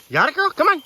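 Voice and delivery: high, loud call to the dog. Real, not acted. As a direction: call her in from a distance. Calling dog back from brush.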